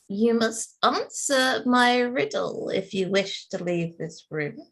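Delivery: high, eerie whispering spirit voice; a disembodied riddler curling around them; thin reedy voice. Acted. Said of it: "suddenly this thin, reedy voice curl around".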